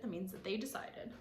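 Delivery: plain reading voice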